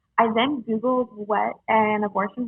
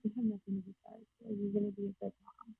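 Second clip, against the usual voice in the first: hushed, tender retelling of a mother's words; retold gentle reassurance; 16 dB quieter, faster than usual. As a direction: drop to a near-murmur to reassure. Mother reassures her apologizing pregnant daughter.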